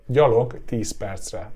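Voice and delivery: gyalog tíz percre said slowly and clearly; slow demo. Shown as a demonstration. Teacher slowly models the short answer. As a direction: lay out each word of gyalog tíz percre. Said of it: "the short to the point answer".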